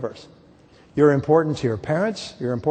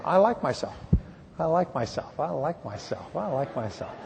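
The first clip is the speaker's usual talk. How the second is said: quiet, fading self-talk; gentle murmured affirmation; softly repeated affirmation; 6 dB quieter than usual. Shown as a demonstration. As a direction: say it softly to yourself, letting it drop. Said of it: "you can raise your self-esteem by just saying".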